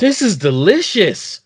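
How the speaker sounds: savoring a sip mid-sentence; while sipping a drink. Real, not acted. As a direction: talk right after a sip. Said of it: "I'm drinking the".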